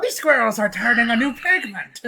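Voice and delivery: hammed-up Irish voice, leaning into the brogue; Irish accent. S0 jokes about squirrels to his friend in a hammed-up Irish accent. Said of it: "That was more Irish than".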